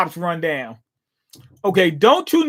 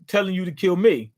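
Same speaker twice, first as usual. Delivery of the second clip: normal talking voice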